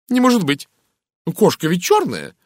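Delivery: high, loud exclamation; incredulous high-voiced lady; flustered American lady, disbelieving. A narrator's reading. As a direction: protest in amazement, pitch jumping up. Narrator voices Mrs Gardner's disbelief.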